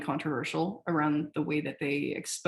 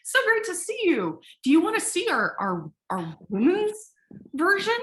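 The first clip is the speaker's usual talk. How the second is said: much higher, louder gushing sales pitch; overeager booth staff; 11 semitones higher, 5 dB louder than usual. Retold or imitated. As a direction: gush like a thrilled salesman seeing a woman. Booth staff greeting a woman.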